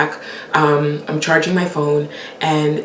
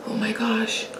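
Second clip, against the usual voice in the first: soft dismay; 5 semitones higher, 10 dB quieter than usual. Retold or imitated. Retold dismay.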